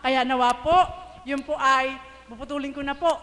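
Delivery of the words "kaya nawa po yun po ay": ordinary delivery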